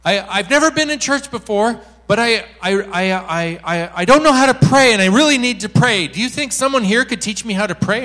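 high, loud, stammering plea; urgent blurting. Retold or imitated. Newcomer asking the speaker to teach her prayer, urgent blurting. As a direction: imitate a newcomer pleading loudly.

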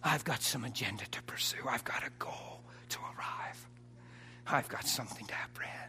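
soft unvoiced whisper of determination; whispered resolve. Listener leaving church with resolve, whispering. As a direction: whisper it like a private vow. Voicing inner resolve quietly.

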